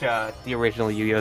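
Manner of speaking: ordinary talk, nothing marked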